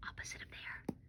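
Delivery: very soft whispered hint. Real, not acted. Teacher whispering a hint between yelled sight words, whisper. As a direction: whisper it as a quick aside.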